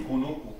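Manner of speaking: "kunuku" with oo in place of each O. Shown as a demonstration. Teacher repeating the ancestors' word, U sound for O. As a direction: repeat "kunuku" with rounded U vowels. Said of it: "like a U".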